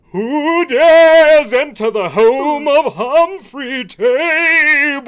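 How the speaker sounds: loud, high, slow ghostly boom; unearthly voice. Acted. Ghost appears to players.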